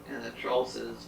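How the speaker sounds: ordinary delivery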